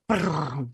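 imitated electric trill; electric download noise. Author showing how the download felt, buzzing trill. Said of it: "It was like this".

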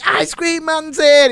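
imitating a kid's excited call, high and drawn out; high, slow singsong announcement; excited child. Child announcing ice cream van.

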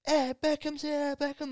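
mimicking fans crying out at the car; crowd calling out. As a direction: call to him through the car window.

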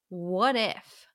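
normal talking voice

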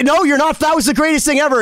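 excited. Retold or imitated. Telling Nuno the solo was great.

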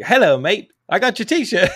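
hamming up an Aussie mate's greeting; Australian accent. Retold or imitated. Host imitating an Australian listener, hammed-up Australian accent. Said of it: "say his accent on the show, and it's gonna be terrible".

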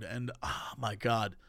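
low, soft confession; hushed adoration. Real, not acted. Confessing to friend a week of watching reviewer, hushed. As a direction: trail down low and soft in fond awe.

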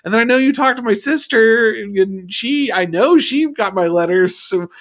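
high, loud, whiny imitation of a letter to parents. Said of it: "little, little whiny".

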